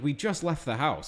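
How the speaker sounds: ordinary delivery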